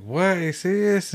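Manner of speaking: high, loud retold disbelief; acted-out astonished question; incredulous. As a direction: react as if you can't believe it. Retold shock at the crash news.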